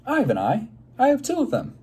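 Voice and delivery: high, loud eager call-out; eager yell. Acted. Morris interrupts the teacher, calling out eagerly and loudly. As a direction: blurt it out proudly. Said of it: "Morris yelled".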